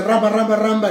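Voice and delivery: mimicking a babbling brother; mocking fake tongues. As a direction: ridicule a fake tongue-speaker. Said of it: "tampoco es el jueguito".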